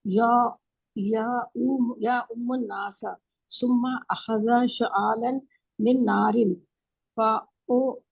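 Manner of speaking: slow measured Arabic reading; Arabic recitation. Real, not acted. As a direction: recite the Arabic text slowly. Student recites Arabic hadith text.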